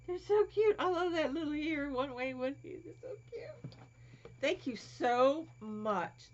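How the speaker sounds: touched, crying a little; teary. Real, not acted. Moved by friend's gift.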